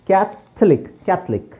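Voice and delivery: ಕ್ಯಾಥಲಿಕ್ as kyath-lik, spaced then joined; correct pronunciation. Shown as a demonstration. Teacher repeating the silent O, correct pronunciation. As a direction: leave out the o in ಕ್ಯಾಥಲಿಕ್. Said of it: "So ಓ is silent".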